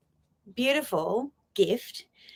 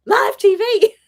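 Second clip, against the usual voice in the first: high, loud words that spill into a laugh; embarrassed giggle on camera; 13 semitones higher, 9 dB louder than usual. Real, not acted. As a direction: laugh at yourself mid-phrase. Laughing at getting emotional.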